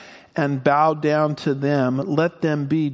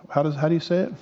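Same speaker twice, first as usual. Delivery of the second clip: ordinary delivery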